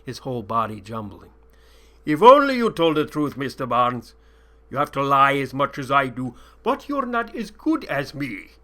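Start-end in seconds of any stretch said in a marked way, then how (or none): 2.1-8.5 mocking sheik. A narrator's reading. Sheik mocking his captive, loud and taunting. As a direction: sneer at him like a sly sheik.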